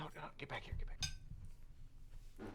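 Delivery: urgent whisper; slowing whisper, get back here. Acted. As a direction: hiss him back quietly. Calling the guard back secretly.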